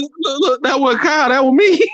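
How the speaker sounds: high squeaky words breaking into laughter; laughing confession. Real, not acted. DJ admitting he set up the roast, laughing. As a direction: giggle out the admission.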